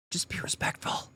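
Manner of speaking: hushed, almost voiceless whisper; whispered plea. Real, not acted. Commentator advising the woman to respect officers, whispered. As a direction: whisper it as a weary aside. Repeats the advice very softly.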